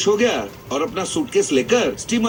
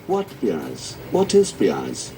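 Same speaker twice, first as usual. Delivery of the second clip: lower, puzzled foreigner's voice; 12 semitones lower, much slower than usual. Acted. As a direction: drop lower, baffled by the word प्याज. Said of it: "Peter ने पूछा". (नीची, उलझी हुई विदेशी आवाज़)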